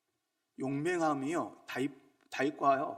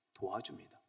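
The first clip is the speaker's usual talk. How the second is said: normal talking voice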